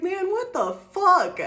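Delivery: high, loud, slow disbelief; mock exasperation. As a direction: stretch out the disbelief like you can't take it. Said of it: "you're like".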